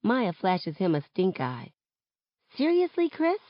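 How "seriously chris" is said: high, incredulous challenge; annoyed disbelief. Acted. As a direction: call out a friend for butting in. Stink eye at pushy friend.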